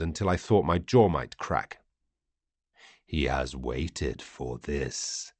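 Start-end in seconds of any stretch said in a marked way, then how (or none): 3.0-5.3 much lower and slow, from deep inside a metal giant; alien lord's voice. Acted. Vati gloating over his captive, low and slow. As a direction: savour each word over the helpless prisoner.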